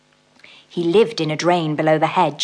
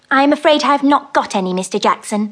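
quick, higher, flustered little mouse; 8 semitones higher, 4 dB louder than usual. Acted. Mrs Tittlemouse tells the toad she has no honey, quick flustered mouse voice. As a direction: hurry out the polite refusal.

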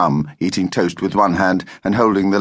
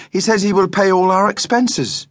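put-on high-pitched child's persuasion; protesting; 12 semitones higher than usual. A narrator's reading. Daughter argues with father about costs, high protest. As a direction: plead the case in a high voice. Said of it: "protested Judy".

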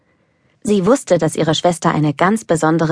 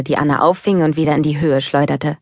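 ordinary delivery, nothing marked

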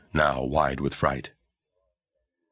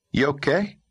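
put-on high boy's voice, shaken; a scared little brother; young boy voice; 11 semitones higher than usual. Boy character voice.

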